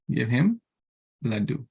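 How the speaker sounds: ordinary talk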